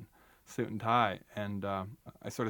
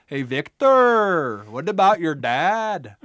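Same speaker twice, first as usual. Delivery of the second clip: loud, higher, slow called-out line; quoted movie line; 6 semitones higher, 11 dB louder, much slower than usual. Retold or imitated. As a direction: call the movie line out across the room. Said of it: "hearing dialogue from the movie".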